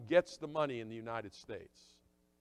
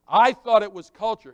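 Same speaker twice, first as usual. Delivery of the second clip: emphatic loud confession; 6 semitones higher, 16 dB louder than usual. Acted.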